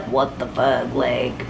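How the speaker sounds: low stunned mutter. Retold or imitated. Sisters in the car muttering low in shock at the scene. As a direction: drop way down and mutter it.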